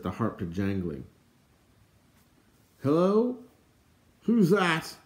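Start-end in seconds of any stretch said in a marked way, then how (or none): 2.8-5.0 high, loud searching call; high questioning holler. Acted. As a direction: call out into the dark, voice pitched up. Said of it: "A voice echoed down the embankment".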